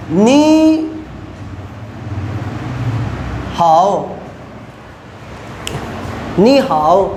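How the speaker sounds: नी हाओ with नी rising and हाओ dipping low; Chinese tone demo. Shown as a demonstration. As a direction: say नी हाओ with the third-tone sandhi, नी rising. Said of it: "means". (नी हाओ में नी ऊपर उठाकर, हाओ नीचे गिराकर)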